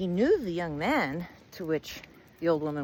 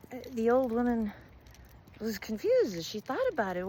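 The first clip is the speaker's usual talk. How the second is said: ordinary delivery, nothing marked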